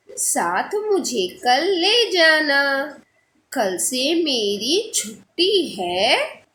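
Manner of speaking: put-on slow, drawn-out children's rhyme; poem recitation. Teacher reading a poem aloud to her pupils, slow recitation. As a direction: recite it slowly, stretching each line end.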